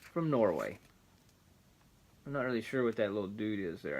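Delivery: ordinary delivery, nothing marked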